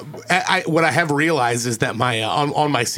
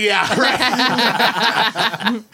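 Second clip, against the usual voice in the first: laughing scoff; 7 semitones higher than usual. Retold or imitated. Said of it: "he's like".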